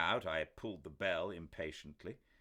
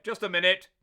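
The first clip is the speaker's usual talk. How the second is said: loud, much higher call through the door; 19 dB louder, faster than usual. A narrator's reading. Doctor calling to Ackroyd through the locked door. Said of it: "called out".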